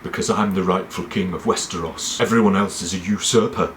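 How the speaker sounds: lightly voiced stern king; understated, solemn claim to the throne; a hint of a dour royal decree; Stannis. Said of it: "He goes like this".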